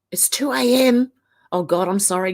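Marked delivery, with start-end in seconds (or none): 0.1-1.1 hushed whisper of surprise. Real, not acted. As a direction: whisper it as if not to wake anyone. Realises how late it is.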